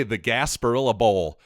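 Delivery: usual speaking voice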